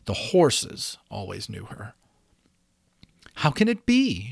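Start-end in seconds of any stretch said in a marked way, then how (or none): 3.4-4.3 high, puzzled musing; wondering. A narrator's reading. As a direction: ask it in bewilderment, pitched up. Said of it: "she wondered".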